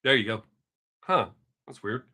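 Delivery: usual speaking voice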